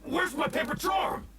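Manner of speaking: a shocked villain crying out for his lost charm; panicked cry. Acted. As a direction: yell out as if the charm just vanished. Villain discovers his charm is gone.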